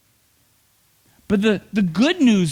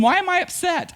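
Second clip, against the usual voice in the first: ordinary talk, nothing marked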